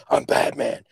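whispery vigilante voice; whispered Batman impression. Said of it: "so it's Batman".

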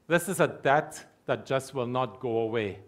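ordinary delivery

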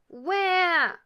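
put-on high, loud crying-baby yell; baby's wail. A narrator's reading. Baby ghost wails once more.

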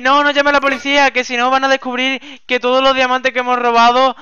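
loud, high, urgent objection; alarmed pleading. Pretend. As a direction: talk them out of it in a panic. Fears police will find the theft.

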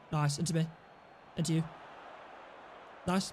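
normal talking voice

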